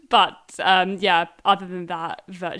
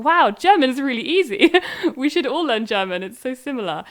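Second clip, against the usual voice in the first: high, loud mock-amazed outburst breaking into laughter; re-enacting giddy enthusiasm; excited amazement; 5 semitones higher, 7 dB louder than usual. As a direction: laugh through an over-keen exclamation, then ease off. Acting out her delighted past reaction.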